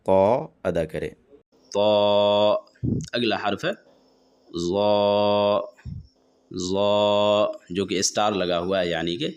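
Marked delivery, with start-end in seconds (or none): 1.7-2.5 طا thick and heavy, louder, not like تا; emphatic ط in طا; heavy taa. Shown as a demonstration. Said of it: "موٹی آواز نکال کر طا ادا کرے". 4.7-7.5 ظا thick and heavy, tongue edge on upper teeth; emphatic ظ in ظا; heavy zaa. Shown as a demonstration. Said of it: "آواز موٹی ہوتی ہے".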